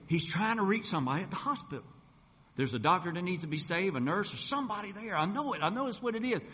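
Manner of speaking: urgent, rising excitement; excited. Retold or imitated. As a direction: re-enact telling wife with conviction. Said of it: "And I got excited".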